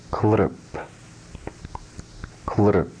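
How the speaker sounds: "Klrp", the tongue-tip l carrying the vowel, no vowel after p; Sanskrit klrp. Shown as a demonstration. Teacher models the rare Sanskrit vowel for students, careful and clear. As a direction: say "Klrp" as one syllable, with a syllabic l and a final p. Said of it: "The only word in the Sanskrit language that has the vowel sound".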